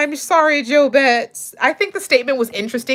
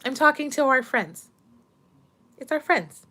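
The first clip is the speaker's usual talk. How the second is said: higher, sing-song voice for her mom; talking to mom; 8 semitones higher than usual. Real, not acted. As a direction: explain gently to mom who walked in.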